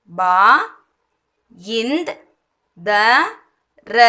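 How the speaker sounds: "பா, இந்த், த, ர" with long pauses; word taken apart piece by piece; sound by sound. Shown as a demonstration. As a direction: isolate each sound for learners. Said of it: "இந்த நா தான் போடுவோம்".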